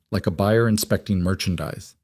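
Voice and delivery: ordinary delivery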